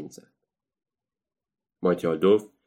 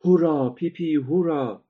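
higher crowd cheer; 8 semitones higher, 3 dB louder, slower than usual. Acted. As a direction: roar it like a whole audience. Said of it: "فریاد زدند".

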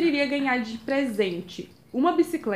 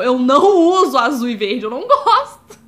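exasperated but amused denial; emphatic protest; 9 dB louder than usual. Real, not acted. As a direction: insist loudly, half laughing. Emphatic mock protest to friends.

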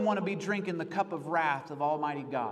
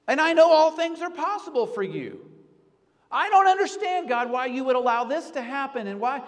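raised, higher pleading voice, crying out to God; pleading prayer; 6 semitones higher, 5 dB louder than usual. Retold or imitated. Preacher voicing a desperate prayer to God, pleading loud. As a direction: plead louder and higher, bewildered.

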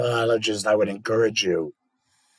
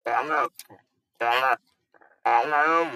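robotic buzzing device speech; electrolarynx voice. Shown as a demonstration. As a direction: speak through an electrolarynx under the chin. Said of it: "I can demonstrate, uh, the electrolarynx".

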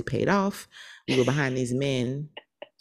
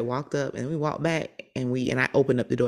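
ordinary talk, nothing marked

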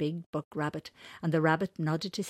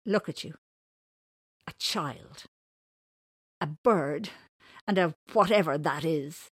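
mild rude dismissal, louder at the end; rudely; 6 dB louder than usual. Acted. Winters eyes those who beat him, rudely. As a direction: list them off with disdain. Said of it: "he said rudely".

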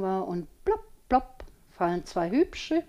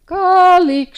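high, loud call of a name; calling across the garden; 10 semitones higher, 12 dB louder than usual. A narrator's reading. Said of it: "schallt es vertraut durch den Garten".

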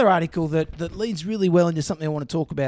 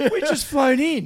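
high-pitched, laughing delivery; 8 semitones higher, 3 dB louder than usual. Real, not acted. Cohost plays along with the witch joke, laughing. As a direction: push the words out through laughter. Both cracking up over masks.

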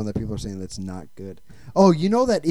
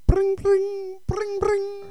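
high-pitched phone ring, voiced; sing-song mock dial tone ring; imitated phone ring; much slower than usual. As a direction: trill "bring, bring" like a ringing phone. Pretend phone call being placed.

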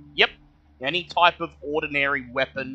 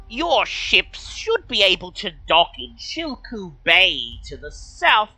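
kobold voice; 8 semitones higher, 3 dB louder than usual. Acted. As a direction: speak as a polite high-pitched kobold.

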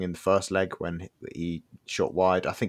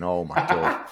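exasperated; 9 semitones higher, slower than usual. Real, not acted. About Norwegian neighbour.

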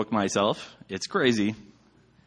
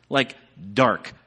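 usual speaking voice

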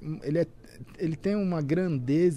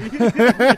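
short, loud burst of laughter; 5 semitones higher, 13 dB louder than usual. Real, not acted. Podcast guest amused by an interruption, laughing loudly. Joking about leaked game on screen.

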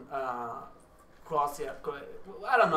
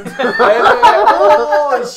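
high, loud laughter breaking into the words; bursting out laughing; 11 semitones higher, 21 dB louder, much slower than usual. Real, not acted. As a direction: laugh hard while starting the line. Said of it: "laughs loud mid-story".

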